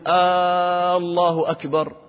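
Allah stretched at the first "الله" vowel, "آه، الله أكبر" like a question; drawn-out opening vowel on "الله", as if asking; mistaken questioning opening. Shown as a demonstration. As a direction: chant a long "آ" before "الله أكبر". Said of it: "هذا خطأ".

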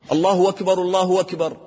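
both "الله أكبر" run on as "اللهُ وَكبر"; dropped hamza. Shown as a demonstration. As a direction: join the phrases, "w" replacing the hamza of "أكبر". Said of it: "هذا خطأ".

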